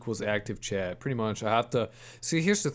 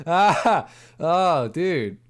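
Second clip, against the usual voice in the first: laughing loudly; 7 semitones higher, 8 dB louder, much slower than usual. Real, not acted. Streamer reacts to losing everything.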